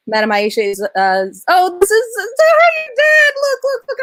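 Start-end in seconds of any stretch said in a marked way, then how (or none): 1.5-4.0 much higher, louder voice, choked up with emotion; overwhelmed, about to cry at the sight of the message; host moved to tears by her guest's father, voice breaking high; near tears. Real, not acted. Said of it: "I'm gonna cry".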